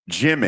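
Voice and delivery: JIMMY as the team owner says it, falling ending; Jerry Jones. Retold or imitated. Hosts trading the owner's name for coach, imitated Jimmy. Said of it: "That's how Jerry Jones always called it".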